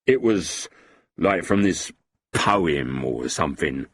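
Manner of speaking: a touch of earnest revulsion, dropping low; sincere disgust. A narrator's reading. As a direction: let real distaste drag the last words down. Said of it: "with what certainly seemed to be sincere disgust".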